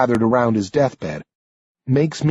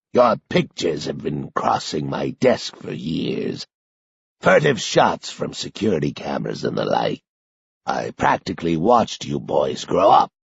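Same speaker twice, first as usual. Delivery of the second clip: doddering grandfather; 8 semitones lower, 4 dB quieter than usual. A narrator's reading. Old secretary recalling watching the boys, low doddering. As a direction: ramble fondly like a frail grandpa. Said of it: "sounds like a reminiscent, doddering grandfather".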